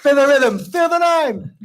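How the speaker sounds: high chanted movie line in mock Jamaican accent. Player warming up with Cool Runnings quotes, Jamaican accent. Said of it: "Give me Cool Runnings".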